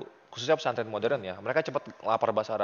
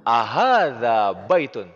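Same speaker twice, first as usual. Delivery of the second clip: slow model phrase; 6 dB louder, much slower than usual. Shown as a demonstration. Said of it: "teacher models phrase to translate".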